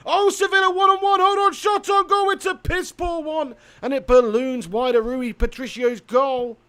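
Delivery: high, loud football play-by-play; mock match commentary.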